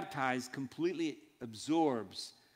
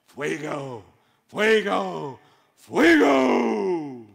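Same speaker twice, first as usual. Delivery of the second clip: fuego shouted louder and higher each time; teriakan makin kuat; 3 semitones higher, 13 dB louder, much slower than usual. Shown as a demonstration. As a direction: build from a loud call to a drawn-out, high shout. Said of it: "fire just didn't sound strong enough".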